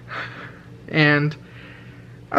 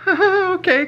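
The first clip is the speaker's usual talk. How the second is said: nervous okay. Retold or imitated. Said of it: "nervous laughed".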